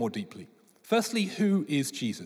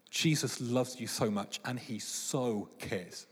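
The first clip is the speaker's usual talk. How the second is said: slow, much softer and lower; tender reassurance; 5 semitones lower, 7 dB quieter, slower than usual. Real, not acted. Preacher softly telling listeners Jesus cares, tender.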